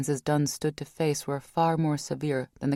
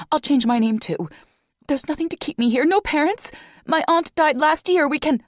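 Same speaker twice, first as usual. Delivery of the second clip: much higher and louder, breathless begging; desperate, out of breath; panting, high-pitched plea; 12 semitones higher, 7 dB louder than usual. Acted. Vanessa begging.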